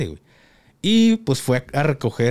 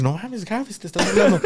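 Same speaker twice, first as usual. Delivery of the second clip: mock critic; 5 semitones higher than usual. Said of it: "van a decir".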